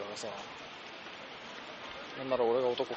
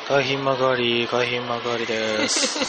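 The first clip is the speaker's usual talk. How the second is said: slow, loud station announcement; train announcer voice; 4 semitones lower, 6 dB louder, much slower than usual. Retold or imitated. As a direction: call out the stop like a conductor.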